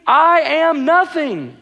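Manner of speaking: high, slow "I am nothing" for a crowd to follow; slow emphatic repetition. Real, not acted. Preacher leading congregation to say it along, slow emphatic repetition. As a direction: draw out each word of "I am nothing".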